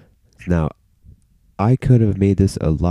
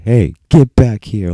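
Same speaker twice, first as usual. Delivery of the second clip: loud, higher, stretched-out call; imitated calling out; 5 semitones higher, 7 dB louder, slower than usual. Staff calling back a student leaving school, loud shout. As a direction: call after someone leaving, imitating an order.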